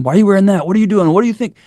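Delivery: mock the nagging father he won't be; lecturing dad. Imitating himself lecturing his daughter, mild mock nagging.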